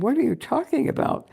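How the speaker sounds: high, loud alarmed question; can't believe what she hears; shocked disbelief. Retold or imitated. Wife hearing husband quit his job, high shocked question.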